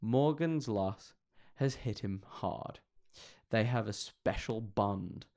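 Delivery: slow, mock sombre reading; solemn reading. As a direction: read it gravely and slowly. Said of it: "said Lisa".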